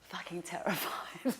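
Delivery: a frightened whispered confession; scared whisper. Retold or imitated. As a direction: whisper it as if you're terrified. Asked how she feels before ceremony.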